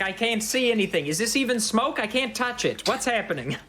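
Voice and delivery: high-pitched, anxious pleading; a guard groping blindly, begging; flustered guard. Acted. Blinded guard begs the party to lift the darkness.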